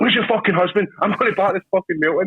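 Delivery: high, raging threat; mocking furious demand. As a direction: threaten in a rage. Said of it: "I was like".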